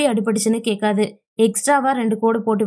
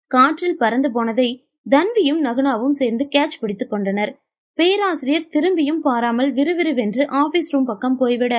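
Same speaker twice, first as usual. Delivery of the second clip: usual speaking voice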